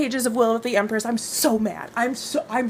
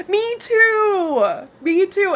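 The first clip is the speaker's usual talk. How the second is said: excited; 9 semitones higher, 3 dB louder, much slower than usual. Real, not acted. Eager agreement.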